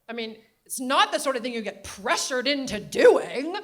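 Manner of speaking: put-on loud, laughing New England accent. Grandmother insisting about Clinton, loud laughing New England accent. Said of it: "New England Katharine Hepburn accent".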